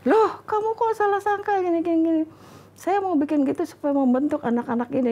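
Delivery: playing the husband, defending himself; mock protesting husband. Wife retelling husband's reply, high protesting voice.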